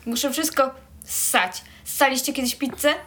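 talking with braces on. Real, not acted. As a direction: teeth braces in the mouth. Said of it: "ma aparat na zębach i nie może jeść".

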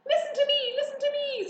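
very high, shrieked demand; screaming. A narrator's reading. Goose competing for pig's attention, screaming. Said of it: "screamed the goose".

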